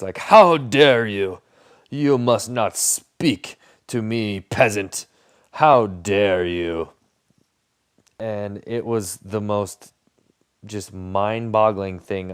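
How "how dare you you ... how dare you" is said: slow, loud, haughty royal voice; pompous monarch. Retold or imitated. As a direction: play an offended king scolding a peasant. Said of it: "as if they were kingly monarchs".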